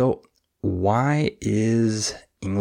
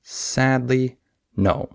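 higher SAD, light "ly", firm "no"; stress, less stress, stress: SAD-ly NO; 5 semitones higher, slower than usual. Shown as a demonstration. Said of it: "and I stress no".

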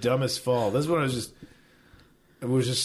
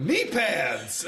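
gleeful retold cry, pitched up; excited; 5 semitones higher than usual. Falling rider celebrating his new gear, excited. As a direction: yell it out, delighted, mid-fall. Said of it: "I was excited".

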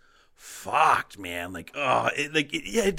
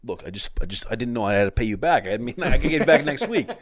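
louder wiseguy pleading through laughs; teasing his friend as a movie gangster, hammed-up plea; mob guy voice; 5 semitones higher, 8 dB louder than usual. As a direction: ham up a movie mobster begging off a debt. Acting a Gotti movie mobster.

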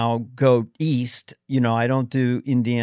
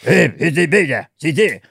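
caveman grunts; 4 dB louder than usual. Shown as a demonstration. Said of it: "the sounds came up".

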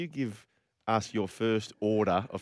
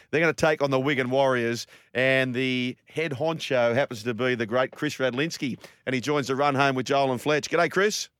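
ordinary talk, nothing marked

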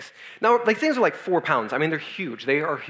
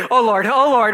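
higher, louder mock anguished plea; loud pained groan; 5 semitones higher, 8 dB louder, slower than usual. Overfull friend pleading on a rocking boat, loud mock groan. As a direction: cry out to the Lord, laughing through it.

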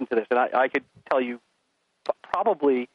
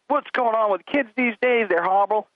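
grumbling adults; 7 semitones higher than usual. Retold or imitated. Adults griping about kids, mock grumble. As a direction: parody the complaining grown-ups. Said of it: "people have these conversations like".

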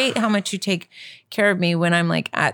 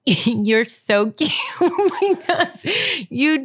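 slow words broken by real laughter; laughing fondly; 5 semitones higher, much slower than usual. Real, not acted. Wife giving husband props for bedtime errands, laughing fondly. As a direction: tell them fondly through giggles. Amused praise of partner, laughing.

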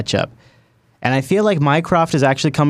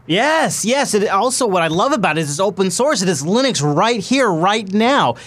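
excited; 6 semitones higher than usual. Real, not acted. Enthusiastic agreement about open source.

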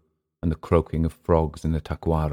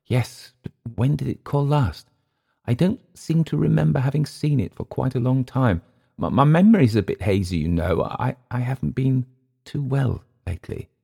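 high, halting, almost shy; embarrassed; 8 semitones higher than usual. A narrator's reading. Englishman admitting his hazy memory to Emile, embarrassed. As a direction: feel your way through the excuse with stumbles. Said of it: "almost shyness in the Englishman's face".

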